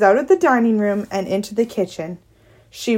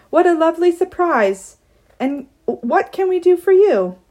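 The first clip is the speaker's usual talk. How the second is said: high, gushing hostess voice; fake-sweet welcome; 8 semitones higher than usual. Mother greeting an unexpected visitor, fake-sweet and high. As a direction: lay on the phony politeness, pitched up.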